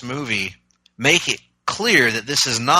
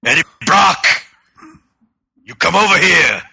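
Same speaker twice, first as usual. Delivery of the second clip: high, loud gruff wrestler growl; Barbarian voice; 6 semitones higher, 6 dB louder than usual. Retold or imitated. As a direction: bellow it like a wrestling heel promo. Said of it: "the Barbarian voice being used for Venom".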